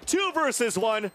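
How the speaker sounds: high, loud excited casting call; hyped play-by-play. Real, not acted. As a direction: call the clutch at full hype. Live play-by-play at a clutch.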